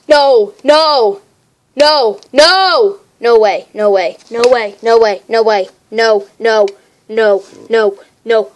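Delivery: loud, repeated cries of amazement; shocked disbelief. Real, not acted. Card collector reacting to a huge pull, loud stunned excitement. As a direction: burst out loud, unable to believe your luck.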